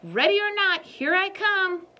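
playful taunting hide-and-seek call; bold challenge. Acted. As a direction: fling it out like a dare to the world. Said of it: "Women who aren't afraid to say to the world".

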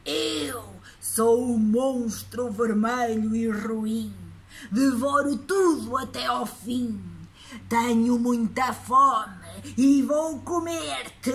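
slow, loud, growling monster; scary monster voice. Acted. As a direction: boom it out like a hungry monster. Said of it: "com uma voz muito assustadora".

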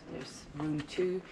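ordinary talk

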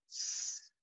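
long voiceless hiss of س; hissed S sound. Shown as a demonstration. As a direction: let the air hiss out between the teeth. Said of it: "يبقى بيطلع إزاي؟".

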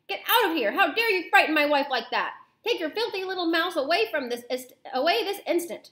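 loud, high, outraged ordering; sustained blustering storybook fury; angry shouting. A narrator's reading. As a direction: bellow at them like an enraged husband. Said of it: "towering over us and shouting".

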